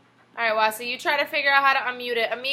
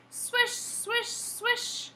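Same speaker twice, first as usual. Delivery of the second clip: soft broom swish; 10 semitones higher, 6 dB quieter, much slower than usual. Acted. Broom sound in story read aloud.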